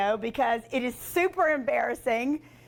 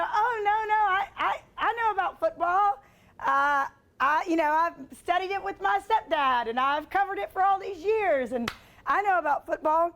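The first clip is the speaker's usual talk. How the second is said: higher-pitched, stammering self-defence; meek protest; 5 semitones higher than usual. Retold or imitated. Host defending herself to a caller, meek high protest. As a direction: imitate a flustered plea of knowing your stuff.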